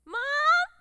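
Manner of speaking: put-on loud, high, drawn-out child's cry; little kid calling. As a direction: yell for mother like a small child. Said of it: "Like a little kid".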